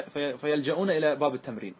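ordinary talk

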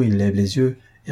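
plain reading voice

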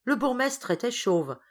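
mildly indignant exclamation; exclaiming, starting high. A narrator's reading. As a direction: open high on a protest, then settle. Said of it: "en s'écriant". (exclamation un peu indignée)

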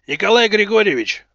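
loud, gruff call down a tube. Acted. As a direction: bark the name into the voice pipe. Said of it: "рыкнул Руднев в переговорную трубу". (резкий окрик в трубу)

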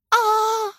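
high, loud scream of fright. A narrator's reading. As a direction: shriek at the sight of rats. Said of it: "закричала она". (пронзительный крик ужаса)

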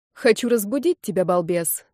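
plain reading voice

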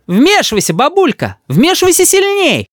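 put-on very high child's voice, egging on; cheeky little boy. A narrator's reading. Boy urges granny to interfere, cheeky. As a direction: pipe up high and goad her. Boy eggs grandmother on against father.

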